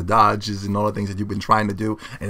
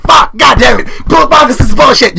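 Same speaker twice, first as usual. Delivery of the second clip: yelling, high and loud, at a lost match; mock furious outburst; imitated rage shout; 12 semitones higher, 11 dB louder than usual. Gamer who just lost the fight, yelling in rage. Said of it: "you're like".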